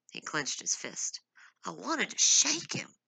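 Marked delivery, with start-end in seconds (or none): 1.6-2.9 high, angry outburst. Acted. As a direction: throw it out in frustration.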